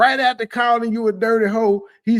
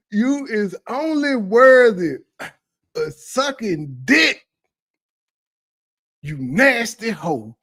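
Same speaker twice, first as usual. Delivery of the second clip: mock preacher insult; 5 dB louder, slower than usual. Parodying the speaker's insult.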